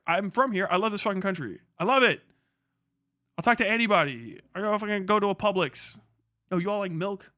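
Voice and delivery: usual speaking voice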